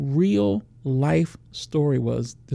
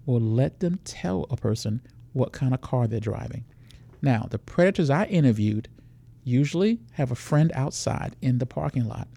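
normal talking voice

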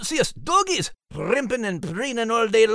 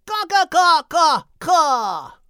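rooster clucking; 9 semitones higher, slower than usual. Acted. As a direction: crow like a rooster trying to win. Rooster crowing contest.

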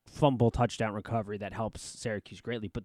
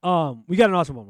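louder, fresh, no yawn; energetic restart; 5 semitones higher, 10 dB louder, faster than usual. Real, not acted. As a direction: shake it off and say it loud and bright. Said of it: "I just yawned".

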